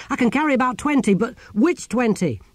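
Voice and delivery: high, loud, hurried start; frantic urgency. Acted. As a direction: call it out while scrambling to choose. Frantic grabbing of books from burning library.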